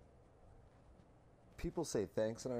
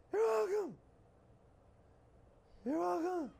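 much higher, frightened mock voice of the kid; scared new hire; 5 dB louder than usual. Mocking a scared new hire to his coworker, frightened high voice. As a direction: squeak it out like a terrified kid. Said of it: "the tone and the intonation is that he should be saying, "I'm frightened".

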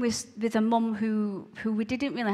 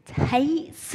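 put-on Glaswegian accent; 6 semitones higher, 5 dB louder than usual. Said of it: "with a strong Glaswegian accent".

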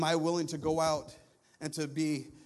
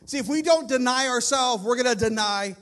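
loud, raised preaching voice; impassioned preaching; 6 semitones higher, 8 dB louder than usual. Real, not acted. Preacher warns congregation about denying God, impassioned. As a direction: drive the warning home to the congregation.

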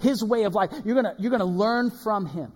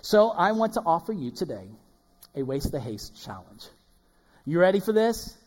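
ordinary talk, nothing marked

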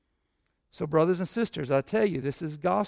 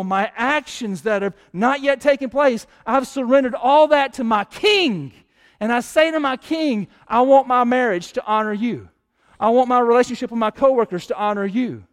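louder, higher preaching that builds to a fervent prayer; preaching at full force; 4 semitones higher, 6 dB louder than usual. Acted. Pastor preaching to the church at full force. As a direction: pour it out to the King, full force.

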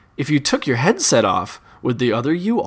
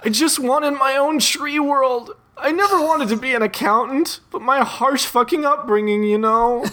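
hammed-up weeping blob; 10 semitones higher than usual. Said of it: "It begins to weep at you".

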